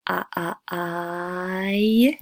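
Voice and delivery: a, a, I drawn out very slowly; diphthong glide. Shown as a demonstration. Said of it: "So we start with".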